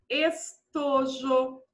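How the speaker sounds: drawn out, syllable by syllable; slow dictation. Shown as a demonstration. Teacher giving pupils a three-syllable example, slowly dictated.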